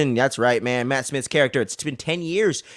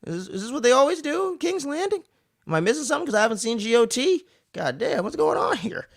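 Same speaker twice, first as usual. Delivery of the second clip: much higher, incredulous mock voice; mock shocked; 9 semitones higher, 4 dB quieter than usual. Mother catching her son at the window, shocked and high-pitched. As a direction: squeal it out in disbelief. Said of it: "I'm like".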